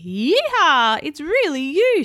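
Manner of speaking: loud, high cowgirl whoop of joy; excited shout. A narrator's reading. Cowgirl hugging Woody, shouting with joy. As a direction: holler it out, overjoyed. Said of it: "she shouted".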